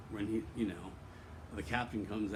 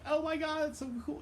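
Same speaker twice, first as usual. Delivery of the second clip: much higher, gushing oh my God; retelling his own giddy excitement, much higher; excited gush. Retold or imitated. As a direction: squeal it like an excited fan. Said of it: "I was so excited that he".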